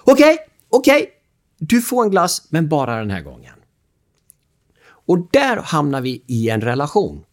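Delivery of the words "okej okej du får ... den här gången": high, loud flustered caving; flustered giving in. Retold or imitated. Father gives in to crying child, flustered. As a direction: cave in all flustered. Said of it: "helt nipprig och säger så här".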